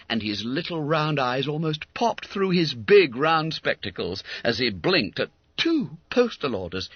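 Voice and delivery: usual speaking voice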